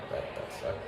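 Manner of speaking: breathy near-silent whisper; whispered aside. Real, not acted. Teller whispers that the firing sucked. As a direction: drop it under your breath.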